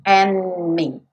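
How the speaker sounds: normal talking voice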